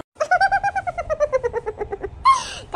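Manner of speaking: long high falling cackle; scheming cackle. Acted. Mother-in-law cackles over her plan, high and long.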